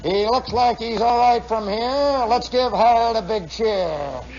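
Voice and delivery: slow, drawn-out loudspeaker announcer; race announcer. Retold or imitated. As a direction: stretch it out like a track announcer. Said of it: "and then they'd say".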